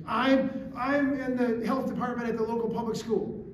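mock shout, slowing to hammer the accusation; imitated angry shouting. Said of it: "she's shouting at her".

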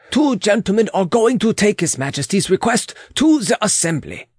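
put-on high, accented servant's voice; reporting foreign attendant. Weber reporting to the Queen, high and accented.

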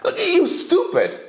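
high, exasperated outburst; fed up with countries buying Russian tech; exasperated scold. Real, not acted. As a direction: scold them like fools.

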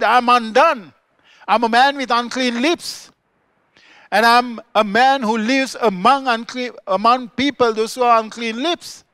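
loud, high, anguished confession; Isaiah's cry. Acted. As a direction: cry it out as a man undone before God. Isaiah's cry on seeing the Lord.

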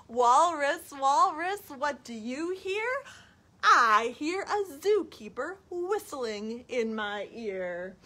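high sing-song call, then dramatic answer; reading to children. Acted. Storytime question and answer about a zookeeper, lilting. Reads picture book to children.

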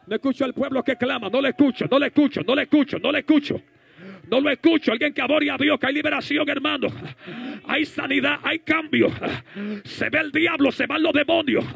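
high, loud, relentless exhortation; full-force preaching. Real, not acted. Preacher rousing the crowd to worship during deliverance, full-force preaching. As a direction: hammer each phrase at the crowd.

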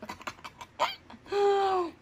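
high giggle. Real, not acted. Delight at the food.

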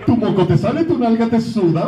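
high, laughing fake Japanese; mock Japanese gibberish. Voice actor mocking how Japanese Freezer sounds. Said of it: "Y se ríe así de".

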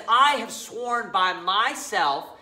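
slow, emphatic divine declaration. Acted. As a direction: quote God, weighting "I" and "myself". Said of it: "by being very emphatic".

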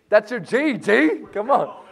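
high, loud hyped-up call; pumped-up teacher; excited hype. Acted. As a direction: sell the G line like a game show. Teacher hypes the G line.

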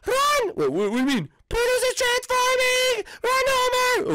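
high, frantic cries to run; screaming a warning in panic; panicked yelling. Pretend. Player fleeing the transformed dog, high panicked shouts.

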